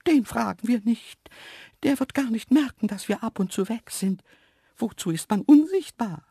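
high, dismissive animal voice; cheeky antelope. A narrator's reading. Antelope dismissing the zoo director, cheeky. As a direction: brush it off pertly, high and loud.

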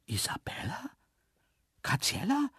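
mildly startled, hushed at first, rising; startled question. A narrator's reading. Man hearing the hidden antelopes answer, startled. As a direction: call the names tentatively, taken aback. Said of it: "fragte ich erschrocken".

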